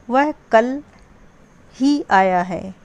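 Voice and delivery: slow, with weight on ही; ही drawn out and stressed. Shown as a demonstration. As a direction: lean hard on ही. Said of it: "ही शब्द पर बल दिया गया है".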